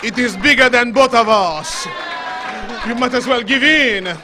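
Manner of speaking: hammed-up French crooner; French accent. Preacher mimicking a B-movie lover to the congregation, in a French accent. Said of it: "he had a thick French accent".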